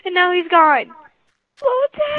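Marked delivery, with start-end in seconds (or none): 1.6-2.2 shrill panicked scream of a name; scream into giggles. Real, not acted. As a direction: shriek the name in fright. Calling out after seeing Herobrine.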